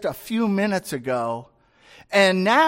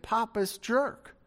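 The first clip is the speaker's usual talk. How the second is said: ordinary delivery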